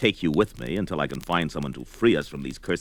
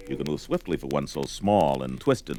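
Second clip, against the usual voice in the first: ordinary talk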